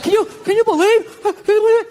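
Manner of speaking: loud, high-pitched mock outrage; hammed-up appalled crowd; mocked shocked people. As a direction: screech in disbelief, way over the top. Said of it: "people are like".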